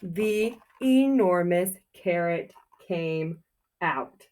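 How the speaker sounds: slow, drawn-out reveal stressing enormous; slow dramatic reveal. Acted. Teacher reveals the carrot to the class, slow and dramatic. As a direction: savour the big moment slowly. Climax of story reading.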